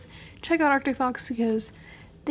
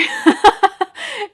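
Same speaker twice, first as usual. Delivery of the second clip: bright burst of laughter; loud laugh; 9 semitones higher, 10 dB louder than usual. Real, not acted. Reacting to her blue hair.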